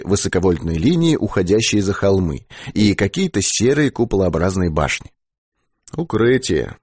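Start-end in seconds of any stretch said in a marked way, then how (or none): none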